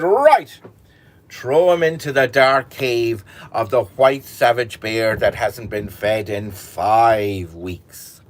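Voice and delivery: sentence him like a raging king; furious order. Acted. King orders shepherd to bear.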